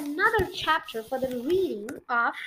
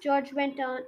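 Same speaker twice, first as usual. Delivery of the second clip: ordinary delivery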